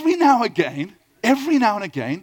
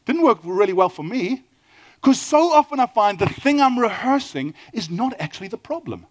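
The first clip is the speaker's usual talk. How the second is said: normal talking voice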